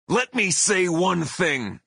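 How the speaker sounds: high, slow, emphatic heel promo; pro wrestler bellow. Retold or imitated. Singer pointing at one hateful guy, wrestler bellow. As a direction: draw out each word like a taunting wrestler. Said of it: "I continued as I pointed".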